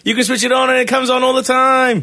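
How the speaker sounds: excited shout. Real, not acted. Toy switched on, surprise.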